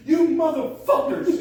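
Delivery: high, loud mock coach yell; coach's outburst. Storyteller playing a coach cursing his players, mock yell. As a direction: imitate a coach blowing up at the team.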